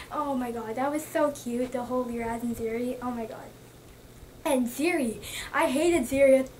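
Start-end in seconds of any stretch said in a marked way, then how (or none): none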